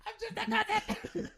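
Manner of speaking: very high, slow, tiny squeak of a voice; squeaky little voice through a laugh. Retold or imitated. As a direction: shrink into a tiny squeaky nobody, giggling. Answering the ghost, tiny self.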